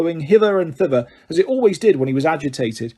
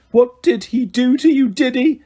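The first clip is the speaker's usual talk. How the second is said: quavering voice; 9 semitones higher, 4 dB louder than usual. Acted. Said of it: "in a quavering voice".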